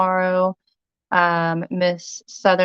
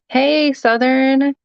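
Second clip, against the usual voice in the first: excited greeting; 7 semitones higher, 5 dB louder, much slower than usual. Real, not acted. Greets a viewer in chat.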